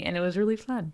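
ordinary delivery

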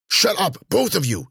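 high, irritated snap; snapped. A narrator's reading. Cece silencing her two complaining companions, snapping. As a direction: cut both of them off sharply. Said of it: "Cece snapped".

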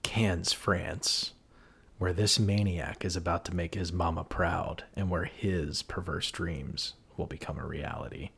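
hushed, low movie-trailer read with France whispered; dark trailer tagline. Pretend. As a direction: sell it like an ominous horror trailer. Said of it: "before I say this alternate case tagline".

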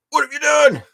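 high dramatic cry; anguished shout. Pretend. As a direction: wail it at the ruined toy. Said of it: "And I captioned it".

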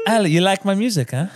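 high, loud mock British voice; botched British, hammed up; fake British accent. Comedian fakes British accent teasing Ali about music. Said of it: "I was trying to do British".

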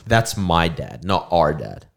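ordinary talk

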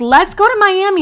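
retold burst of eager excitement; excited suggestion. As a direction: pitch it way up like a spontaneous plan. Retold spontaneous trip suggestion.

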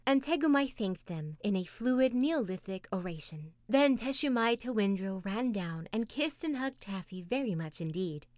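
usual speaking voice

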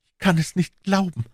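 a moan of grief. A narrator's reading. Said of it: "stöhnte er". (stöhnend, viel höher)

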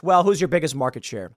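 ordinary talk

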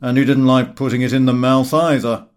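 loud, joking put-on voice for Gowing; facetious Gowing. A narrator's reading. Gowing teasing the host about his wine, loud and facetious. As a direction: deliver the jibe with sly amusement. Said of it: "Gowing facetiously replied".

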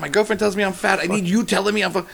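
imitating his own indignant reply; exasperated retort. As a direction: throw it back at him, incredulous and annoyed. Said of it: "I go".

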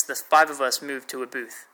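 usual speaking voice

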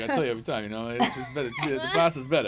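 imitating the New York Italian dad's accent. New York Italian dad bragging to his son, light accent. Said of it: "I love his accent".

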